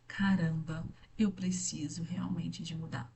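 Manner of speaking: soft, breathy self-talk fading to a whisper; a hushed dawning resolve; quiet realization. Retold or imitated. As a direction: say it to yourself, dropping into a whisper. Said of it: "eu falei".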